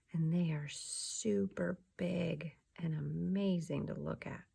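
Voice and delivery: gentle ASMR whisper. Acted. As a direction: breathe the words like a secret.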